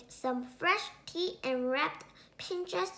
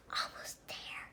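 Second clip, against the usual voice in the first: breathless whisper; sneaking goblin under his breath; 10 dB quieter than usual. Acted. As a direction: whisper it while tiptoeing.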